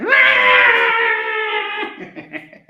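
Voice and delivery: loud, high, rasping cat yowl; imitated cat screech. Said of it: "Every time I do my cat sound".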